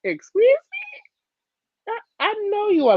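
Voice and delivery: very high, sassy "excuse me"; mock indignant. Retelling her reply to the man on the street, high and sassy. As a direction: pitch way up in disbelief. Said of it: "And I'm like".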